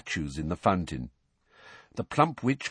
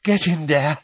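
out of breath; 6 semitones higher, 5 dB louder than usual. A narrator's reading. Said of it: "he panted".

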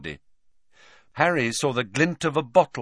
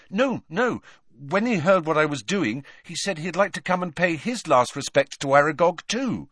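lightly voiced boy, higher; 8 semitones higher than usual. Harry reassures Hagrid about Slughorn, lightly voiced. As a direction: reassure the big man.

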